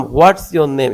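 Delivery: loud on "What's", then dying away through "your name"; falling intonation. Shown as a demonstration. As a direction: hit "What's" hard and let the rest fall. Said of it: "এটা প্রথমে কিন্তু জোরে বললাম, তারপর আস্তে আস্তে থেমে গেলাম".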